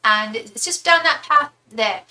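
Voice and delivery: put-on British accent, giving directions; British accent with a slowed, helpful delivery; British impression. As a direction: imitate a helpful British lady. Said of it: "that British impression".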